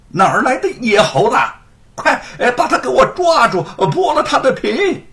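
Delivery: high, loud shouted orders; loud yelling. A narrator's reading. Chief constable ordering men after the monkey: loud yell. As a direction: bark for them to grab it. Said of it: "大嚷".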